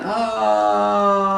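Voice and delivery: high, loud cartoon cry of falling; falling scream. Winnie Bijou plunging to earth, high loud scream. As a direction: let out a long high yell as he drops.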